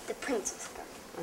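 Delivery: unvoiced whisper. Real, not acted. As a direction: breathe it in a whisper. Child whispers correction.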